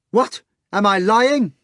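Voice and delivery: high loud challenge; yelling. Acted. Natasha yelling at the hog, high and loud. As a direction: yell it at him. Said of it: "Natasha yelled at the hog".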